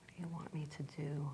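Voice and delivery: faint whisper to oneself; barely audible, hushed question. Real, not acted. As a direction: whisper it inwardly, waiting for an answer. Repeats question to her inner voice.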